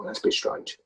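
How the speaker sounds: soft whisper to yourself; whispered puzzled thought. Retold or imitated. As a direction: whisper it, puzzled by the silent house. Thinking in silent house.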